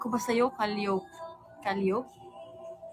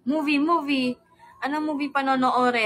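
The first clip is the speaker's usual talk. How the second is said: excited; 5 semitones higher, 6 dB louder than usual. Acted. Friends excited for movie.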